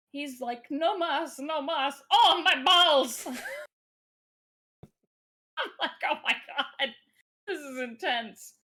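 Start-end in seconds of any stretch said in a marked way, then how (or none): 0.7-3.3 high, loud comic pleading building to a yell; hammed-up pained shout. Imitating the turtle being beaten up. 6.1-8.5 high-pitched, laughing exclamation; laughing disbelief. Retold or imitated. Retells own amused reaction, laughing.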